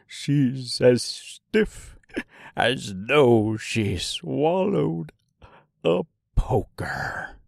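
slow, drawn-out menacing clown; a creepy, slow insult; sinister voice. Acted. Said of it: "read him with a little bit of a sinister voice".

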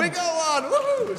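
high-pitched whooping cheer; excited cheer. Real, not acted. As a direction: whoop like you just won. Host cheers finding car owner.

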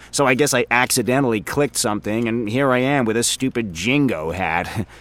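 a rambling, self-amused office guy; chatty coworker voice, in a different timbre from the narrator; Frink, chatty coworker. Acted. Frink voice again.

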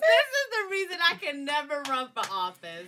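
loud exclamation. Real, not acted. Podcaster protesting over the others' laughter, loud exclamation.

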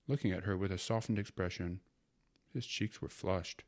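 plain reading voice

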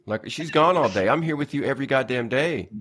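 jokingly furious dad ranting at his son; mock outraged rant. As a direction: rant at the toddler like a jilted lover.

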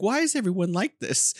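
puzzled Southerner, a touch affronted; put out. Acted. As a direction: ask it like you're a bit put out. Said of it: "they seem, like, kind of put out".

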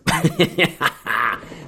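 quick high laugh; high burst of laughter. Real, not acted. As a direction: laugh out loud. Laughs about Hellfest.

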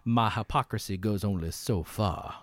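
low, slow movie-quote drawl; quiet, drawn-out Southern gambler impression; Val Kilmer. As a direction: imitate a languid gunslinger, soft and unhurried. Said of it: "One of them being like".

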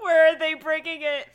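high, loud, laughing question. Real, not acted. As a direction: ask it while laughing. Laughing at submarine joke.

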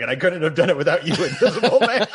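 fast, laughing, rising to high; laughing mock Tom Cruise. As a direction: laugh through a hammy quote. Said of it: "Tom Cruise was like".

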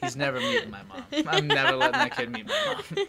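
high-pitched laughing protest. Real, not acted. Joking about Anthony and her mom.

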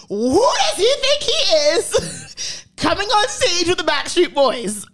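high, slow, exaggerated outraged-mom imitation. Daughter mimicking her mom's outrage at [name] on stage, high and slow. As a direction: ham up a scandalized mother.

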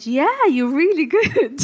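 lightly voiced kind teacher; mock teacher cheering her on, laughing; teacher's praise. Teacher praising young student.

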